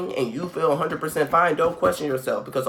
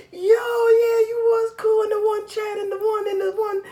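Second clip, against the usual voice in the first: very high, squealing mock greeting; hammed-up thrill at spotting a chat friend; excited greeting. Meeting an online friend at a retreat, excited mock greeting.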